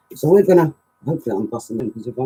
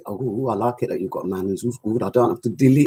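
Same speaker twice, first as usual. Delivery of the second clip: ordinary delivery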